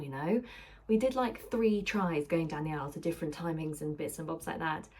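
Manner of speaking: normal talking voice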